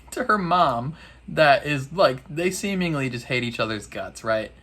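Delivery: normal talking voice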